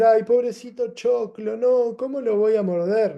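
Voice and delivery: high, slow pitying whine over a corn cob; mock pity. Teacher mocks pity for the corn cob, high slow whine. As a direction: coo over the poor corncob, pityingly.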